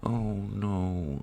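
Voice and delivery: usual speaking voice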